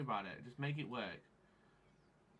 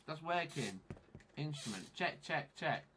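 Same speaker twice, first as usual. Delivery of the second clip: usual speaking voice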